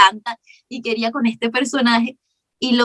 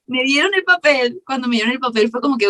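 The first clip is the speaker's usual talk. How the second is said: usual speaking voice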